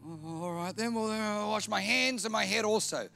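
high, put-on voice giving in; Peter relenting. Peter gives in to Jesus.

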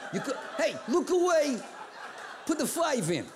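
high, laughing, pushy voice of a bill; defensive fifty dollar bill. Acted. Fifty dollar bill talking the giver out of it, high and pushy. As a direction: wheedle and push, laughing through it.

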